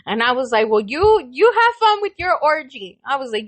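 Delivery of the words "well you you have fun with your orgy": mock humouring. Humouring the patient.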